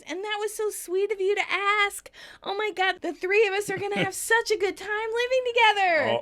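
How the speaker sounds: high, gushing sweetness; over-sweet excited gush; cooing. Retold or imitated. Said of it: "And Rachel coos".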